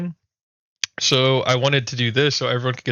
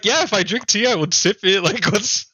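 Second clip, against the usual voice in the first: laughing; 8 semitones higher than usual. Retold or imitated. Mocking the tea sip idea.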